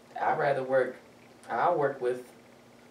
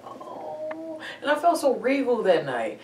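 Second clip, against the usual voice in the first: normal talking voice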